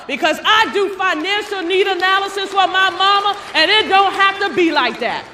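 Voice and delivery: loud, high rally preaching; fiery preaching. Real, not acted. Speaker rallying sales agents, loud preaching. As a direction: preach it hard to the hall.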